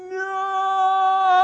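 high, loud, drawn-out desperate cry of no; a long helpless wail of no. Retold or imitated. Husband watching his wife unleash the dog, crying out no. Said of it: "with everything in me going".

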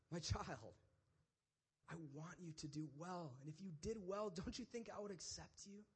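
soft, tender fatherly appeal; God. Acted. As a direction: speak gently, as to a beloved child. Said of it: "comes down to his level".

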